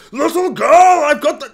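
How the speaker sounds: loud, high, hammed-up bear shout; polar bear voice. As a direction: burst straight into a booming bear accent. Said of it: "not do such a polar bear voice".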